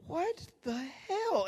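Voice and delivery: high, slow, drawn out; baffled. Retold or imitated. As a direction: stretch it in disbelief. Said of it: "I'm just like".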